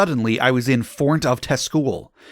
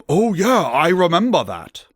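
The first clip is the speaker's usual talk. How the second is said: lightly put-on voice, pitched up; trying to sound gothic; 7 semitones higher, 4 dB louder than usual. A narrator's reading. Dumbledore recalling their meeting, trying to sound gothic. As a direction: overdo a would-be goth's exclamation, louder and higher. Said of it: "trying to be all gothic".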